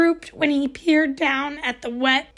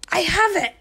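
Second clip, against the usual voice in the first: excited idea; 6 semitones higher than usual. A narrator's reading. Sudden idea.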